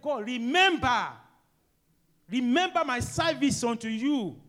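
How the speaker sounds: desperate, pleading prayer at full voice; loud plea. Acted. Hezekiah begging God aloud in loud desperate plea.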